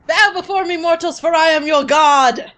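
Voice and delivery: booming god-like decree; dark lord. Acted. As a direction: proclaim it like a dark lord demanding worship. Dark lord proclamation to podcast hosts.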